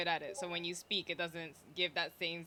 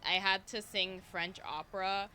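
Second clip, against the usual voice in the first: ordinary talk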